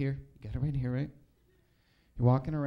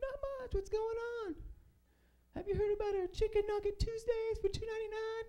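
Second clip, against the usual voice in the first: much higher, perky salesy voice; chirpy brand voice; 3 dB quieter than usual. Retold or imitated. McDonald's answering the comic, in a perky high sales voice. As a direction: pitch the deal like a peppy mascot. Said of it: "And McDonald's was like".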